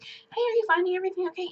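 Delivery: mimicking a store manager checking on a customer, pitched well up. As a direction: imitate the shop manager's high greeting. Imitating store manager greeting her.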